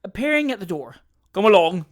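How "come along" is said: loud brisk call to move; cried out. A narrator's reading. Wardle hurrying the party off, loud call. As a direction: call out, hurrying them along. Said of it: "cried Wardle".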